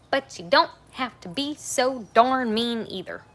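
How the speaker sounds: loud, slow, scolding emphasis; slow down and lean hard on don't. Real, not acted. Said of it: "a little louder for the people in the back".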